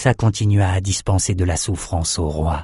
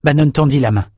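plain reading voice

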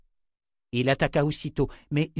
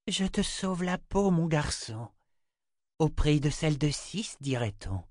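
higher-pitched, silky seer; coy enchantress; 5 semitones higher than usual. Acted. Chota to Richard whom she rescues, coy. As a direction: tease with easy superiority.

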